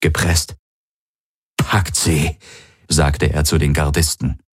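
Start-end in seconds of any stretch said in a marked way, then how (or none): 1.6-2.4 strained order. A narrator's reading. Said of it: "Jedes Wort klang angestrengt, gepresst".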